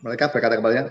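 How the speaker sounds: ordinary delivery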